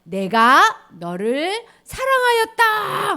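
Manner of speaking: loud, high, slow proclaiming; big loud declaration. Acted. God declaring love to people, loud and slow. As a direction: announce it grandly and slowly. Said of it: "하나님이 그렇게 크게 말씀하셨어".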